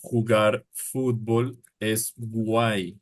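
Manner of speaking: jugar fútbol es guay said slowly; slow example. Shown as a demonstration. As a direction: spell it out slowly for learners.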